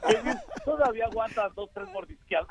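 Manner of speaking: laughing through the words. Real, not acted. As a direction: chuckle while repeating it.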